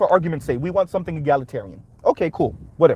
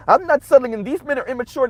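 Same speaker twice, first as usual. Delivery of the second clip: higher-pitched mock woman's voice; mocking, pitched-up imitation of women; mocked women's complaint; 7 semitones higher than usual. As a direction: mimic complaining women in a high put-on voice. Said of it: "when these women say".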